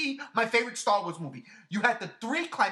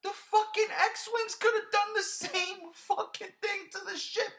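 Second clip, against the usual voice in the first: exasperated rant; 11 semitones higher than usual. Real, not acted. Complaining to his co-host, high and incredulous. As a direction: stretch it out in disbelief.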